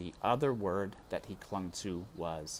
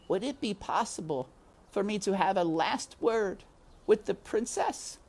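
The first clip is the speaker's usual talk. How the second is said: high, small mouse voice asking politely; a hopeful little mouse making a request; voiced high, as a small mouse; timid mouse Despero; 11 semitones higher than usual. A narrator's reading. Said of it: "Despero asked".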